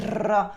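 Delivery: "r" trilled with the tongue tip; rolled R. Shown as a demonstration. Teacher imitating Lumière's Italian-sounding accent, "r" rolled. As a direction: roll the "r" in a very non-French way. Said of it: "he rolls his R's".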